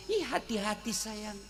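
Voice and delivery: soft, tender, caring husband; sweet newlywed murmur; tender to his bride. Retold or imitated. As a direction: coo it gently to the new wife. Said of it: "Waktu belum menikah".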